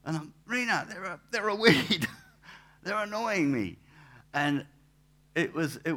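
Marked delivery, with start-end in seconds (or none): 0.5-3.8 exasperated retort. Retold or imitated. His retort to Rena.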